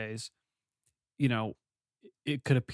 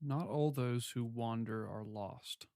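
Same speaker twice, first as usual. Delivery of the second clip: reflective; 2 semitones lower, 9 dB quieter, slower than usual. Acted. Musing over the quote.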